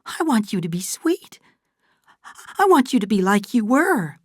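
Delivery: high, distressed plea; pleading. Acted. Fay pleads with changed Kate.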